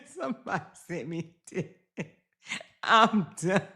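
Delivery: trying to talk through the giggles; breaking into laughter. Real, not acted. Cracking up at a photo.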